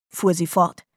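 ordinary delivery

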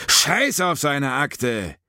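loud, high, drawn-out angry outburst; angry interruption. A narrator's reading. Sinclair cutting off his colleague over a file, angry outburst. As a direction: cut in sharply, high and forceful. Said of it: "unterbrach Sinclair Atkins".

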